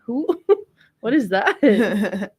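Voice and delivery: usual speaking voice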